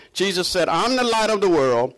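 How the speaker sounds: ordinary talk, nothing marked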